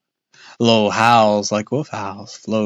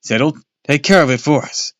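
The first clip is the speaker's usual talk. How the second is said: louder, higher eager plea; pleading cry; 6 semitones higher, 7 dB louder than usual. Acted. Boy begging his dad to go inside. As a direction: beg hard. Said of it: "Luke cried".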